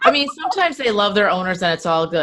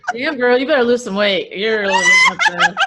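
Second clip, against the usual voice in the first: much higher, louder, laughing through it; laughing horse insult; 4 semitones higher, 5 dB louder than usual. Retold or imitated. Horse mocking the rider's weight, laughing hard. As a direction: tease her while cracking up.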